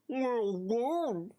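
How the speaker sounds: slow, odd cartoon toad voice; slow toad-voiced reply. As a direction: stretch it out like a goofy toad. Second take in Toad voice.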